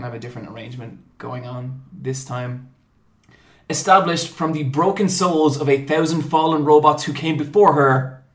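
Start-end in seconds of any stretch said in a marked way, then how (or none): 3.7-8.2 big, loud ringmaster introduction; booming mock-epic host intro; show announcer. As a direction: proclaim it grandly, punching key words. Continues the grand introduction.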